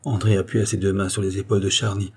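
usual speaking voice

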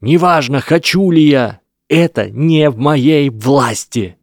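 high, loud and slow; desperate shout. A narrator's reading. Harry shouts back at Lesath just as desperately. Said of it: "Гарри кричал так же отчаянно".

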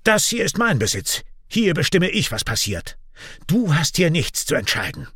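high-pitched, angry assertion. A narrator's reading. Raik claiming his property before Kian, angry. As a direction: lay down the law, angered. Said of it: "Raik wird wütend".

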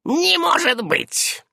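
high, drawn-out disbelief; incredulous old witch, pitched high; doubting. A narrator's reading. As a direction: exclaim it slowly in doubt. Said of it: "усомнилась старая ведьма".

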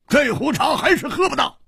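usual speaking voice

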